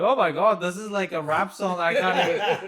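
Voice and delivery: high-pitched laughing disbelief; mock overwhelmed; retold amazement. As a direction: react as if overwhelmed, half laughing. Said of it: "I'm like".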